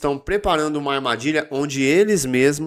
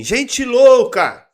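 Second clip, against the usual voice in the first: high, raised proclaiming shout; impassioned public call, pitched up; crying out loud; 7 semitones higher, 5 dB louder than usual. Acted. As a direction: cry out to the crowd in reproach. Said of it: "Ela está gritando alto assim".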